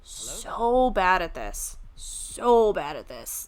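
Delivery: drawn out. Pretend. Drawn-out self-mockery.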